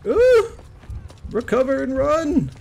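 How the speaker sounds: very high, loud yelp then urgent command; excited alarm. Real, not acted. Gamer knocked down, urging himself to escape, excited. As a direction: squeal then hurry yourself away. Gamer in danger mid-fight.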